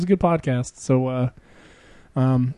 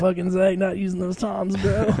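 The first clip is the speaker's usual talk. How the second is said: higher, lightly mocked bro voice; imitating the old bandmate, mildly hammed; mock dude voice; 6 semitones higher, 3 dB quieter, slower than usual. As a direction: parody the guy's one memory. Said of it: "He's like".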